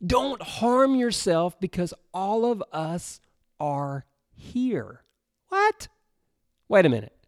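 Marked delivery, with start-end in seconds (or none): 0.1-5.0 understated calling out, higher and slowed; mild call out. A narrator's reading. Paul calling out to the jailer, mildly urgent. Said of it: "Paul called out in a loud voice". 5.5-5.9 shocked squeak, much higher; incredulous what. Real, not acted. Preacher reacting to Paul staying, shocked squeak.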